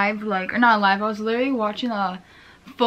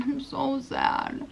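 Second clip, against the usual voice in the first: sad; 4 semitones higher, 5 dB quieter, much slower than usual. Real, not acted.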